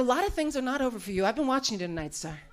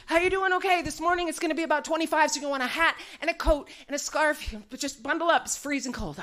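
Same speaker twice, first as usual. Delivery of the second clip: pitched-up, overeager TV forecast voice; nervous weatherman; 3 semitones higher than usual. Retold or imitated. Frantic Albuquerque weatherman doing his forecast on screen, high and jittery. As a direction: rattle off the weather like a jittery morning anchor. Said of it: "he's like".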